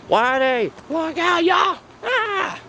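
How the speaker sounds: high urgent shout to a friend; calling out a warning. Acted. Toy cowboy warns his pal of danger, urgent high shout. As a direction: yell the warning, pitch climbing.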